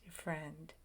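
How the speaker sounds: ordinary talk, nothing marked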